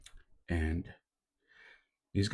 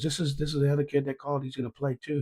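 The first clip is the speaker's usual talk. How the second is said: put-on higher, fast kid voice rushing the words; eager kid; 8 semitones higher, faster than usual. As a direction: rush it like an excited teenager. Kid introduces another player.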